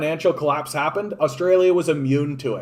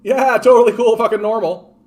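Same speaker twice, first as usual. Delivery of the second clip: loud, high sarcastic agreement; imagined European reaction, hammed-up and sarcastic; mock approval; 7 semitones higher, 7 dB louder than usual. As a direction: play up the phony approval, voice lifted. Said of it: "be like".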